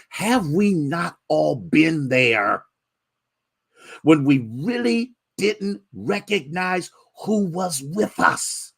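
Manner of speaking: high, slow, ringing preacher cadence; preaching at full force. Acted. Pastor preaching to his congregation, fervent high preaching. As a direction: stretch every phrase out to the pews.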